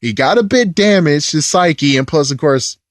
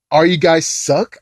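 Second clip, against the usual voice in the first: ordinary talk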